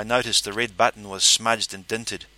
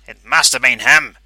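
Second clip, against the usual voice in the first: loud accusation; 3 semitones higher, 11 dB louder than usual. A narrator's reading. Pinky accuses.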